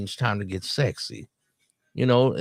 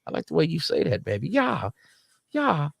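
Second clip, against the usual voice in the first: high, breathless-style swoon in play; hammed-up aroused pleading, pitched way up; mock turned-on; 9 semitones higher than usual. Man swooning at her accent, mock turned-on. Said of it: "when she say it like that".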